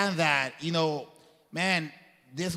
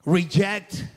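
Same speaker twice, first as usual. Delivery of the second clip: forceful reject; 4 dB louder than usual. Shown as a demonstration.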